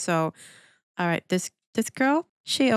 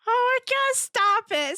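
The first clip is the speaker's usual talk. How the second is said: excited squeal; 14 semitones higher than usual. Real, not acted. Reacting to handsome boy.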